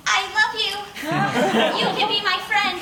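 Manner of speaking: put-on very high, laughing little child; toddler voice. As a toddler facing the wolf mask, high adoring child voice. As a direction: play his baby self, adoring.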